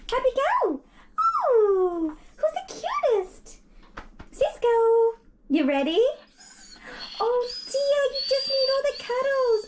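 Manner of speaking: very high, sing-song talk to a pet. Real, not acted. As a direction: coo at the dog in a squeaky voice. Dog groomer baby-talks the dogs.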